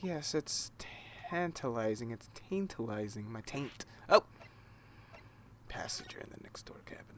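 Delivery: soft unvoiced whisper. Real, not acted. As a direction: whisper it secretly.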